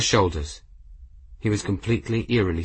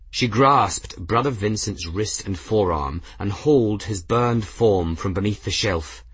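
ordinary delivery

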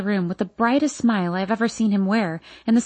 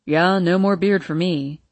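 father's voice; 3 semitones lower than usual. A narrator's reading. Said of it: "he says".

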